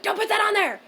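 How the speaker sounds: sharp high shout; stern mom yell. Retold or imitated. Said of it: "and Mom went".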